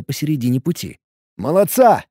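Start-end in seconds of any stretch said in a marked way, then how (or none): 1.4-2.0 higher, cheery shout of praise; hearty praise. Acted. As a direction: praise the drone with gusto. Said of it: "похвалил я".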